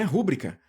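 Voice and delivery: "rúbrica" with the weight on RÚ; RÚ-bri-ca, stressing the start of "rúbrica"; stress on first syllable. Shown as a demonstration. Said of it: "Se ela fosse rúbrica". ("rúbrica" com força na primeira sílaba)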